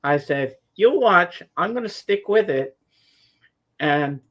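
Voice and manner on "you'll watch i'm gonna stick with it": defiant reply. Retold or imitated. Retold defiant answer to dad.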